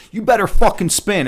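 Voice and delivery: a touch of an angry mother; mock angry mother. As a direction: bark it like a fed-up mom. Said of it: "Her mother's like".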